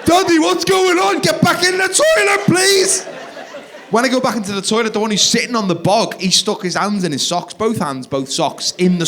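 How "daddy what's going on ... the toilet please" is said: high, slow, loud mock yell; frantic shouting. Across the dance floor to the toilet, frantic shout. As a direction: holler it in comic panic.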